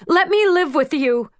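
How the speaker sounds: put-on high, urgent child's plea; a goddaughter begging to stay; girl begging. A narrator's reading. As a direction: beg to come along.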